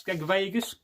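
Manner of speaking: usual speaking voice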